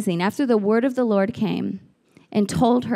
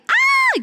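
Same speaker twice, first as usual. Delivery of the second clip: high-pitched joyful shriek; excited yell; 4 dB louder than usual. Acted. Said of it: "yelling at Mary".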